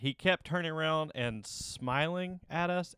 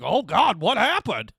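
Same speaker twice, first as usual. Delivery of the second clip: high, loud mock alarm; hammed-up shocked stranger; fake alarm; 7 semitones higher, 10 dB louder, slower than usual. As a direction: gasp it like something huge just happened. Guy fooled by pointing at ring.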